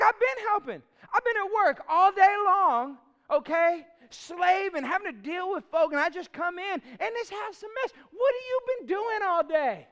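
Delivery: high, loud, aggrieved complaint that peaks in a shout; mock-furious husband airing grievances; building to a shouted accusation; heated rant. Husband venting after work.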